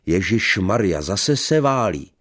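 loud, high furious bellow; angry roar. Acted. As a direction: roar at her in a rage. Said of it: "zařval vztekle". (vzteklý řev)

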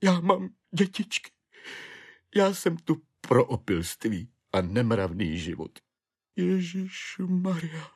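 high, tearful wailing lament. Acted. Despairing clerk laments his children and wife, high tearful wail. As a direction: shriek it out between sobs, mildly. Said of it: "vyrážel ze sebe skřeky".

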